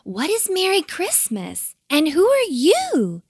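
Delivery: high, questioning small-bird voice; brave little kiwi. A narrator's reading. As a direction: pipe up boldly at the stranger. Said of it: "said Tiny bravely".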